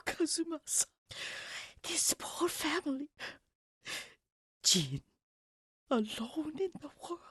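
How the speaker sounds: a high, hushed, tearful whisper; tearful pity. Acted. Grieving healer to Kazuma, tearful whisper. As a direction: mourn the boy gently, near tears. Grieving over orphaned Jin.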